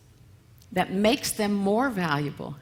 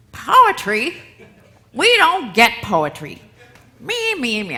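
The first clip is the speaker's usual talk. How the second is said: higher, louder outraged inner voice; mock inner critic; 3 semitones higher, 8 dB louder than usual. Her inner committee protesting the poetry class, outraged. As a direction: squawk like an indignant inner committee.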